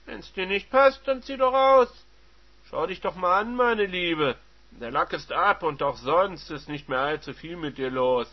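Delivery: loud, mocking retort; sneering husband. Husband mocking his wife, loud and sneering. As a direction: belittle her with cold contempt.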